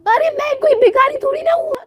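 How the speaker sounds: very high, tearful offended bride; whiny crying protest. Acted. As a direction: wail it, hurt and insulted. Soumya insulted, given money. (रोती हुई, बहुत ऊँची आवाज़ में)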